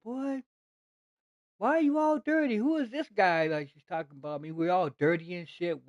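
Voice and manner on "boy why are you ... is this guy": much higher, mock grandma scolding the boys. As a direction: play the grandmother demanding answers. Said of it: "his grandma said".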